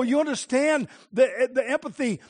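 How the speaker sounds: usual speaking voice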